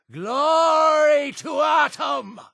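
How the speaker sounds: very high, drawn-out cry of worship; exultant zealot shout. Acted. Zealot hailing Atom, exultant shout. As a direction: cry it out to the heavens, slowly.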